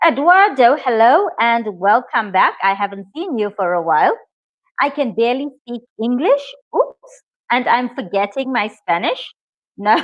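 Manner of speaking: normal talking voice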